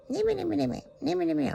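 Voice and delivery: mock yourself being nippy and whiny; whiny moaning. To viewers, imitating her own grumpy moaning, high whiny whinge. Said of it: "I was moaning".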